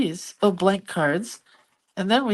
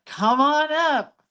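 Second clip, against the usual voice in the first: cheery invitation; 8 semitones higher, slower than usual. Real, not acted. Inviting a friend onto the land.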